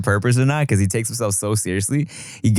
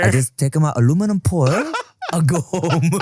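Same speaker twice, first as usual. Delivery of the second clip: put-on laughing, higher Korean-accented riff; 5 semitones higher than usual. Co-host joining the apology riff, mock Korean accent.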